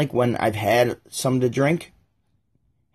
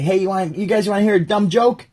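higher, rising offer; eager pitch; 6 semitones higher, 3 dB louder than usual. Acted. Guy trying to infiltrate the group, eager pitch.

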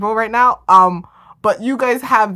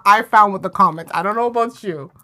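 ordinary talk

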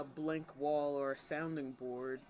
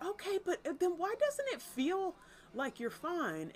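pleading question; 13 semitones higher than usual. Retold or imitated. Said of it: "being like".

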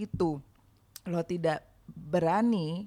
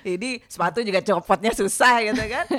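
loud, higher, laughing delivery; amused; 5 semitones higher, 9 dB louder than usual. Real, not acted. Joking with co-hosts about awkward substitute weapons, laughing. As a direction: say it loudly through real laughter.

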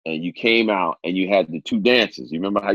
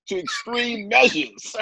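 laughing; 4 dB quieter, slower than usual. Real, not acted. Laughing while telling the story.

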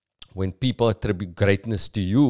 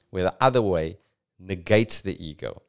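ordinary delivery

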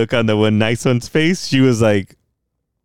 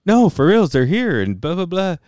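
high-pitched, louder insistence; insisting, voice swooping up high; insistent retort; 9 semitones higher, 3 dB louder than usual. Retold or imitated. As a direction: push back in a high, singsong protest. Acted argument with emergency staff.

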